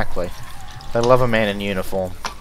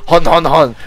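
loud hammy French hon hon hon; mock French laugh; 5 semitones higher, 8 dB louder than usual. Mocking French stereotype laugh.

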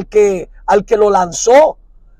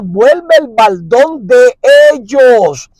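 slow, drawn-out solemn prayer; much slower than usual. Retold or imitated. Preacher voicing Nehemiah praying slowly and solemnly. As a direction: plead it slowly to the Lord. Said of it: "Nehemías dice y ora".

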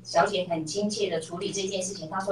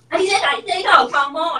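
higher, louder imitated scolding; mock angry outburst; 8 semitones higher, 5 dB louder than usual. Mom mimics her old self scolding the clerk over the hair, mock angry. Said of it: "以前的我啦".

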